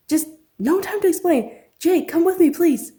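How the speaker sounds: loud, high and hurried; urgent orders while rushing out. Acted. Urgent dash to find Annika.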